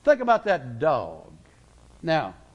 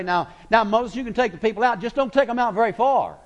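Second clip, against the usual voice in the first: higher, louder, faster bargaining pharaoh; wheedling Pharaoh; 6 semitones higher, 4 dB louder than usual. Acted. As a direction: coax Moses like a sly pharaoh making a deal. Preacher acts Pharaoh bargaining.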